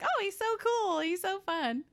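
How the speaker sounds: put-on high, gushing childish adoration; gushing kid. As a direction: swoon over him like a starry-eyed kid. Mocking kids adoring Fred.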